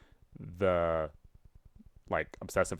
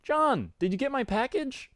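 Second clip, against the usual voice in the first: very high, eager character voice; high, excited greeting in a put-on voice; Garden Gnostic; 14 semitones higher than usual. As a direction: put on a high-pitched chatty friend. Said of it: "put some effort in this voice".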